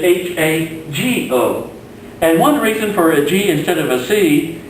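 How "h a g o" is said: slow spelling. Shown as a demonstration. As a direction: spell H A G O out slowly, letter by letter. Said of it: "Instead of H A C O".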